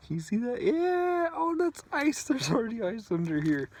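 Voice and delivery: surprised. Real, not acted. Finds ice on the car.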